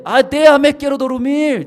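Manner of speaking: high, loud whiny complaint; woman woken at night, high and loud; annoyed sleeper. Retold or imitated. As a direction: push the voice away, half asleep.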